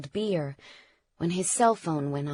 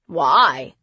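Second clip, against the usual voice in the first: loud, low demand; loud, urgent outburst; shouted question; 4 semitones lower, 7 dB louder than usual. A narrator's reading. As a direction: shout it as a challenge. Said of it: "Tristan shouted".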